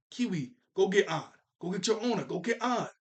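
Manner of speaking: urgent pet-owner orders, lightly acted; mock urging. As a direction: act out shooing a pet off on an errand. Acted command to the dog.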